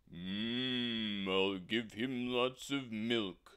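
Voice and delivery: humming, generous cow; gentle cow. Acted. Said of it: "said the cow".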